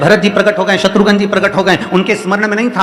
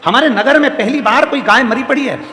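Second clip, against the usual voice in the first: high-pitched, quicker run through the news; urgent report; 6 semitones higher than usual. Acted. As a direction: report it in one raised sweep. Same report repeated, urgent. (ऊँचे सुर में, बिना रुके खबर)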